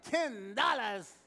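high, slow, stretched-out disbelief; a pastor mimics his shocked congregation at the price; mock shocked. Loud high mock outrage at the price. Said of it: "You're like".

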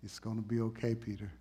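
soft reassuring Jesus; tender Jesus. Retold or imitated. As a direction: reassure him gently. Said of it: "eyes of love".

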